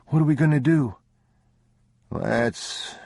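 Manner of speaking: put-on a small boy looking up at Papa, worried; frightened son asks father what to do; young boy voice. Boy voice again.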